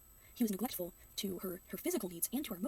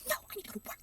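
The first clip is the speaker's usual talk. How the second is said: much higher, sharp mock snap; snapping mom; 12 semitones higher, faster than usual. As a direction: imitate a mom snapping at her kid. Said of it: "She just kinda snapped at her kid".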